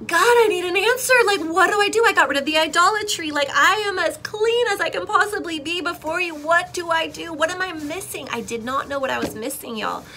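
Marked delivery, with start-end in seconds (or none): 0.0-8.3 high, loud pleading prayer; desperate plea. Retold or imitated. Believer begging God for an answer, desperate plea. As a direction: beg for an answer, acted out. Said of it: "I didn't know what to do".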